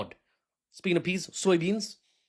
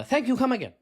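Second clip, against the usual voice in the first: mock shop clerk farewell; 10 semitones higher, 8 dB louder, faster than usual. Mock shopkeeper send-off.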